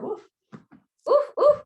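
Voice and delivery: high, loud puffed oofs; real high-pitched grunts of effort; exertion oofs. Real, not acted. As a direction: let out sharp oofs of effort. Exertion near end of workout.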